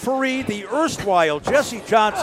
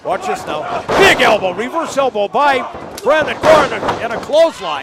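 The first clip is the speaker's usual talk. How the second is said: rising, louder call of the action; excited play-by-play; 3 semitones higher, 5 dB louder than usual. Real, not acted. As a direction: call the moves as they land. Calling live wrestling action.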